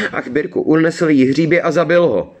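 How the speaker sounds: high, distressed outcry; anguished cry. A narrator's reading. Girl tells her brother of the killed foal, crying out desperately. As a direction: cry out in despair, pitch held high. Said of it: "vykřikla".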